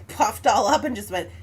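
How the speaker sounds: normal talking voice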